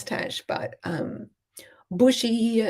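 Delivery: ordinary delivery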